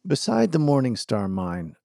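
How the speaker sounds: usual speaking voice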